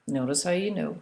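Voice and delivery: usual speaking voice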